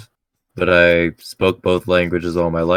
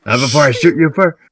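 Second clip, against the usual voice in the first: defiant gunslinger; 6 semitones higher, 6 dB louder, faster than usual. Acted. In-character standoff reply.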